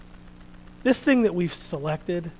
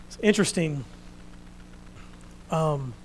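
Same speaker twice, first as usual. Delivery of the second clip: ordinary talk, nothing marked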